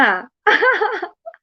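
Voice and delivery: high burst of giggles; quick, high-pitched laughter; real laugh. Real, not acted. Laughs after reading a teasing comment.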